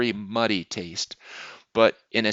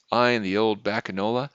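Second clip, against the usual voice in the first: plain reading voice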